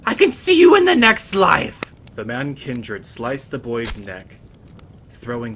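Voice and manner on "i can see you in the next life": frantic lute player. Acted. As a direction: shriek him on to kill. Lute player urging the kill.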